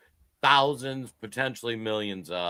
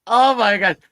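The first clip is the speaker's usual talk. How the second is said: surprised; 10 semitones higher, 11 dB louder than usual. Real, not acted. Host reacts loudly to rapid list.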